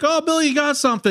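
high, bright mock-excited question; retelling greeting Bill about his new car, high and eager. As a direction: pitch it up like spotting an old friend's new car. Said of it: "I was like".

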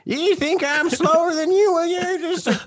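high taunting call with a laugh in it. Acted. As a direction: yell a mocking challenge across the street.

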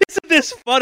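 high, loud mock glee; gleeful monster. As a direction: play the monster having a great time. Imagining gleeful monster attacking screaming people.

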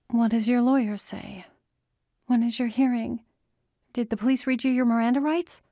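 high, urgent string of questions; worried daughter's rapid-fire questioning; anxious questioning. Acted. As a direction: press for answers, speeding up at the end. Daughter anxiously fires questions at father.

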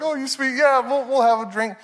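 lightly voiced doting mom; tender doting parent. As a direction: coo at a toddler you adore. Parents forcing themselves to accept.

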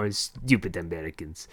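mock-French accented jibe. Frenchman scoffing at Americans, mock French accent. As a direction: sneer it in a hammy French accent. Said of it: "Frenchman outfit".

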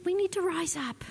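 hushed urgent whisper. Real, not acted. Pastor urging the church to rise up, whispered. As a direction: breathe the call to rise. Exhorting congregation.

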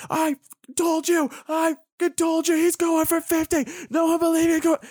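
high-pitched, crowing I-told-you-so; squealed mock triumph; excited gloating. Retelling excited gloating on FaceTime.

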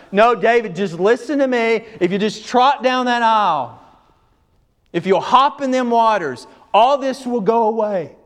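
high, loud, coaxing revival pitch; mock pushy preacher. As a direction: ham up a slick altar-call preacher. Mocking a pushy altar-call preacher.

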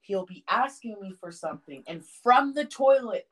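usual speaking voice

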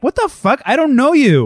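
high, loud indignation; indignant retort. Retold or imitated. Woman rejecting Dracula's claim, loud and indignant. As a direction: snap back, offended.

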